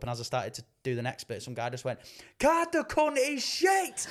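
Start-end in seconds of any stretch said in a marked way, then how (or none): 2.4-4.1 high slow drawn-out jeer; heckler jeer. Retold or imitated. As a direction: drag out the insult like a rowdy heckler. Heckler shouting at comedian.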